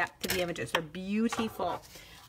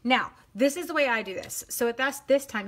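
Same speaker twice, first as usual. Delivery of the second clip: ordinary delivery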